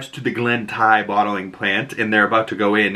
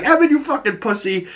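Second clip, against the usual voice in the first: much higher-pitched quoted insult; mock exasperated squeal; 13 semitones higher than usual. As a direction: imitate a fed-up girlfriend, pitched way up. Quoting exasperated girlfriend's insult to boyfriend.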